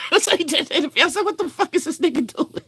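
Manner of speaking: =very high, cracking up mid-sentence; laughing while talking. Real, not acted. Reacting to the singer's act, helpless laughing speech. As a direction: squeal the words through laughter.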